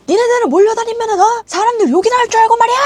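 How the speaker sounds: mock shout, high and loud; high, fast-rising imitated rant; boss's angry scolding. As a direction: imitate a boss berating staff. Imitated boss scolding staff.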